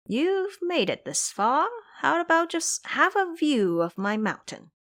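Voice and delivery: lightly voiced monkey king, easy and sly; monkey king coaxing. A narrator's reading. Xing Zhe invites Ba Jie.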